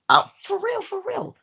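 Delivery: high, eager "for real"; high-pitched emphatic repeat; excited insistence. Real, not acted.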